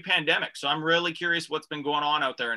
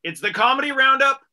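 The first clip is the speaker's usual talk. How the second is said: radio host intro; 4 semitones higher, 5 dB louder than usual. Acted. Host opening the radio show, announcer voice.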